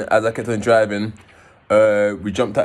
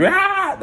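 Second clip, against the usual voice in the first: high, startled mock scream; imitated scream; 3 dB louder than usual. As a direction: let out a woman's startled shriek, imitated. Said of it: "So she screamed".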